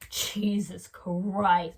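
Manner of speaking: slow, drawn-out pretend disgust; mock offended. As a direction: stretch each word as if appalled. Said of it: "be so offended".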